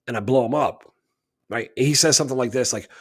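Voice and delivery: ordinary talk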